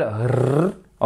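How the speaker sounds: "R" as a rolled trill; Spanish rolled R. Shown as a demonstration. Teacher compares languages, "R" trilled like Spanish. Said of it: "on le roule pas autant qu'en espagnol".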